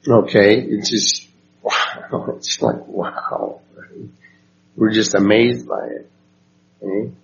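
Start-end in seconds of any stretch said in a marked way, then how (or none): 1.6-3.6 hushed awe. Shown as a demonstration. Showing what awe feels like.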